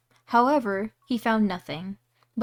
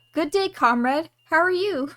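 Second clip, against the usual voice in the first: high, louder bold hello; brave greeting; 7 semitones higher, 5 dB louder than usual. Acted. Tailor boldly greeting the giant, higher and louder. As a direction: puff up and greet him boldly. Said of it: "The little tailor bravely said".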